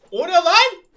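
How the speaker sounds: loud, high what-was-that; startled cry at gunfire; sudden loud alarmed question; startled shout. Real, not acted.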